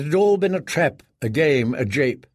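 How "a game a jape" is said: usual speaking voice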